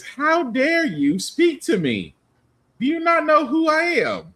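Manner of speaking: high, indignant how dare you; mock offended Uhura. Host voicing Uhura's look, mock indignant. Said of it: "That look right there".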